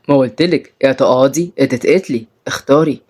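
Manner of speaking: low, menacing captor voice; threatening captor. Acted. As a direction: lay down an ultimatum, low. Salim delivers ultimatum.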